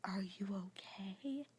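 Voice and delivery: whispered, slow and soft concern; calm husband. Retold or imitated. Husband checking on his gagging wife, calm whisper. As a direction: hush it gently. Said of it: "my husband is so calm".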